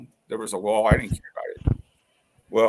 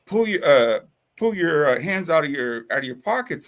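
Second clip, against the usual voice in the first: mildly raised, higher retold order; cop's command; 5 semitones higher, 5 dB louder than usual. Officer with hand on gun orders him, commanding. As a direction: imitate an officer ordering someone, a bit louder.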